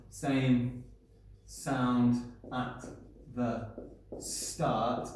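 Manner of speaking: each word set apart; slow and spaced. Shown as a demonstration. Teacher to class, slow and deliberate. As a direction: spell out same, sound, start one by one.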